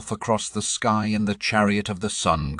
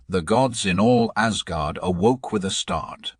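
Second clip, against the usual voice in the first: ordinary delivery, nothing marked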